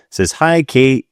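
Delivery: normal talking voice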